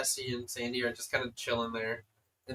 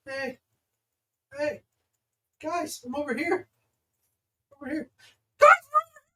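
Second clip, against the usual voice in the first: high, eager call across the hall; eager Kato; 6 dB louder than usual. Acted. Kato calling the group to the table, eager high call. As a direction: wave them over, getting louder. GM voices Kato waving them over.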